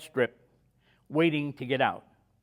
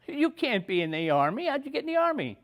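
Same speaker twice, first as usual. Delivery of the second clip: much higher, amazed doctor's question; incredulous doctor; 11 semitones higher than usual. Retold or imitated. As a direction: rise sharply in disbelief. Doctor amazed he's in Army.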